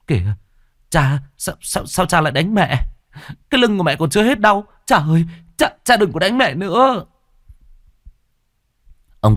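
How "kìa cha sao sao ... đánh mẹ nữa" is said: high, stammering plea; trembling voice. Acted. Son begs his father to stop beating mother, trembling voice. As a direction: plead shakily, pitched well up. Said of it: "giọng run run".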